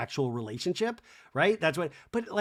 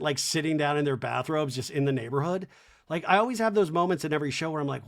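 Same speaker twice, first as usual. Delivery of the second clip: usual speaking voice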